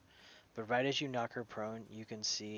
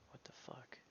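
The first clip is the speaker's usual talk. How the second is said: hushed disbelieving whisper; whispered swear; 3 semitones higher, 17 dB quieter than usual. Real, not acted. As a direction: mutter it under your breath. Reacting to surprising rules.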